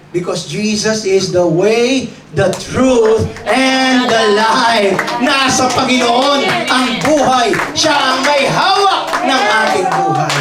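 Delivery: full pulpit voice; preaching. Acted. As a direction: build it like a sermon climax. Preaching climax.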